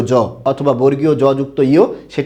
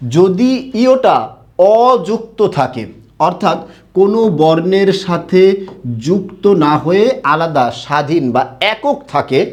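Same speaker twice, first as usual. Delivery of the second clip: slow emphatic; slower than usual. Shown as a demonstration. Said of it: "teacher stressing rule".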